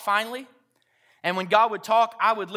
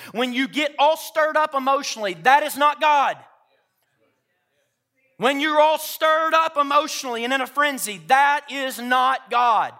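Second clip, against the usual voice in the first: raised, high, insistent exhortation; full-force preaching; 7 semitones higher, 6 dB louder than usual. Real, not acted. Preacher warning those stirred up emotionally, loud and emphatic. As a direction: drive it home loudly to the crowd.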